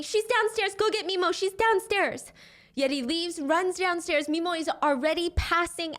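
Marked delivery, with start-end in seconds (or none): 0.0-2.3 higher, louder urgent cry; retold scream. Grandma screaming at Yeti to find Mimo, raised urgent cry. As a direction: push the warning out high and urgent. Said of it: "and she's screaming".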